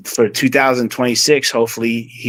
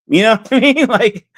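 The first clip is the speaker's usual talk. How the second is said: laughing; 9 semitones higher than usual. Real, not acted. Laughing while describing old days.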